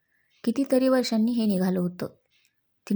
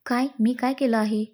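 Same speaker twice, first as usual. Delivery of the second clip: ordinary delivery, nothing marked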